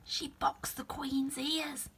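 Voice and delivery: slow, soft, high whisper; rabbit whispering. Acted. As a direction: confide it secretly.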